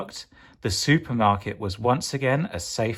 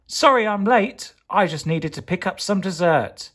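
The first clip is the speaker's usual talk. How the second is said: jaunty hero; 7 semitones higher than usual. Acted. Hero returns jokingly.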